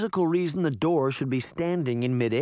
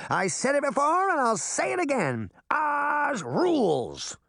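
Puck cheering after the melting; gleeful cry; 13 semitones higher than usual. Acted. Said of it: "Puck cried".

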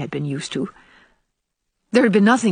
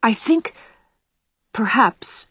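halting, higher, with a long pause; hesitant; 4 semitones higher, slower than usual. A narrator's reading. Anne venturing new ideas about heaven, hesitant. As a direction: venture it tentatively, word by word. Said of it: "she began hesitatingly".

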